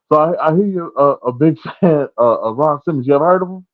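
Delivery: lightly teasing Ron voice. Retold or imitated. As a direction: tease with mock innocence. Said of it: "Ron's like".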